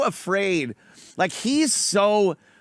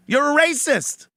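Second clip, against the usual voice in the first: louder, higher accusation; 6 semitones higher than usual. Real, not acted. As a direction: turn the quote into a direct charge.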